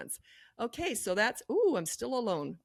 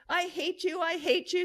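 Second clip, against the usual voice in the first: put-on child tantrum; 10 semitones higher, 4 dB louder, slower than usual. Child yelling at parent.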